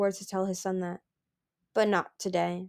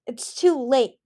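growl; 5 semitones higher, 6 dB louder, slower than usual. Acted. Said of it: "Gil growled".

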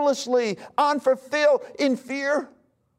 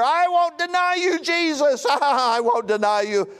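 Peter's boast; 4 semitones higher than usual. Acted. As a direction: play Peter swearing loyalty, with a laugh. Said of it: "remember Peter".

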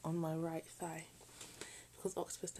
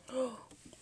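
sudden gasp; 7 semitones higher than usual. Real, not acted. Cheese fell.

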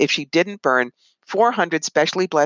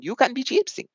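mock Russian accent; 8 semitones higher, 3 dB quieter than usual. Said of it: "the bad Russian accent".

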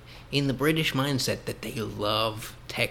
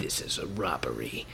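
mock hushed robber; 9 semitones lower, 8 dB quieter than usual. Playing at robbing a bank.

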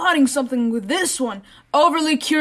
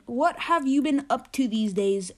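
ordinary talk

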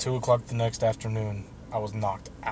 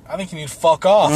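loud, higher-pitched angry comeback; angry retort; 5 semitones higher, 9 dB louder than usual. Retold or imitated. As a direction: fire it back loudly, retelling a blow-up. Retold angry outburst at counsellor.

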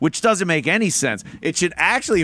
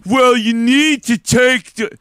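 higher, louder, slow dopey voice; moron voice; 7 semitones higher, 6 dB louder, slower than usual. Retold or imitated. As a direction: play the universal dim-witted guy, drawn out. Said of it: "That's the moron voice".

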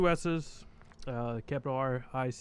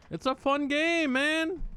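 high-pitched protest; 11 semitones higher, slower than usual. Real, not acted. Defending his game, high voice.

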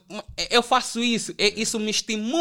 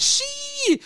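a high, drawn-out mocking "xiii"; mock sarcastic squeal. Imitating Júlio teasing him, with a high mocking squeal.

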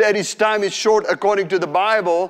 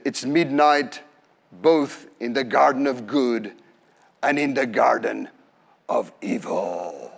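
low possessed murmur; strange voice; 6 semitones lower than usual. Retold or imitated. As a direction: intone it like a voice from somewhere else. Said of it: "in a very strange tone of voice".

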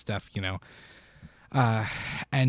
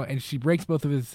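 ordinary talk, nothing marked

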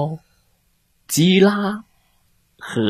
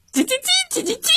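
very high, soft whispered cheeping; whispered chick cheeps; 3 dB quieter, much faster than usual. Acted. Chicks on the desks, cheeping in a whisper. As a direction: breathe out tiny cheeps.

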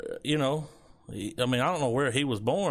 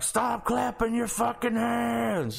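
slow, high, angry yell at the stage; mock crowd shout; 10 semitones higher, slower than usual. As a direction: bark it at the singer, fed up. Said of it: "The guy in the crowd was like".

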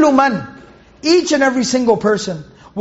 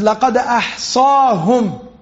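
fast, flowing chanted recitation of the verse; Quran recitation; much faster than usual. Acted. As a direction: recite it with tajweed. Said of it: "Allah subhanahu wa ta'ala says".

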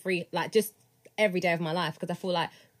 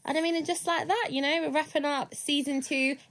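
outro announcement; 6 semitones higher than usual. Acted. Host signs off season.

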